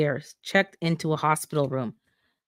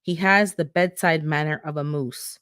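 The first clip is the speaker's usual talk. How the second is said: ordinary delivery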